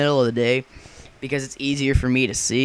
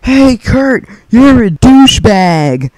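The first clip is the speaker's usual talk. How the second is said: much higher, much louder mocking shout; taunting yell; 9 semitones higher, 11 dB louder, much slower than usual. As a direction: yell the taunt across the street. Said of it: "and like".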